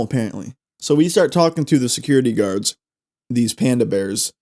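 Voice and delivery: ordinary talk, nothing marked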